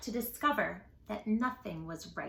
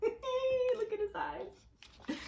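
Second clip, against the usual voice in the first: much higher, slow giggling; laughing; 11 semitones higher, 4 dB quieter, much slower than usual. Real, not acted. Teacher giggling at the picture, laughing. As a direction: laugh through it.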